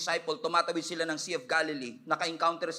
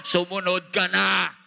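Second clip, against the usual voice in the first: loud, slow command; booming, drawn-out command; louder, insistent voice; 3 semitones higher, 7 dB louder, slower than usual. Acted. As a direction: call it out as an urgent divine order. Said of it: "lumalakas ang boses ng Panginoon".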